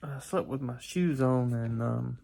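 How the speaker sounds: sleepy, groggy voice; drowsy just-woken mumble; just woke up. Real, not acted. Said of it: "I'm waking up from my nap".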